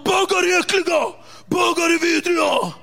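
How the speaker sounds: macho bully rant. Retold or imitated. Zoran ranting to the kid, mock shout.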